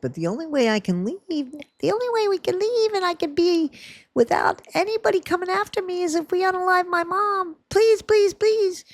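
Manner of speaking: high, begging girlish put-on voice; high-pitched mock plea, pleading please. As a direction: whine it up high like a desperate girl begging.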